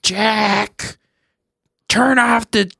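put-on high, nagging old grandma voice; slow, exasperated grandma yelling at grandpa; imitating grandma scolding grandpa about subtitles. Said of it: "my grandma would always be like".

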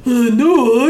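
normal talking voice

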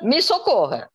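ordinary talk

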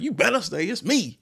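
high-pitched playful demand; mock insistent inner voice. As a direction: act out the clingy side of yourself, high. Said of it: "Part of me is like".

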